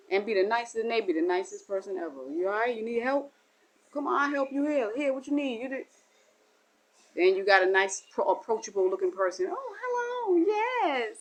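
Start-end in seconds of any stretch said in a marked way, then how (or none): 2.3-5.9 higher, bubbly offers of help; sweet busybody; eager helper. Retold or imitated. Overly nice helper voiced. 9.5-11.2 much higher, sing-song greeting; put-on sweetness; sugary fake hello. Approachable person's front.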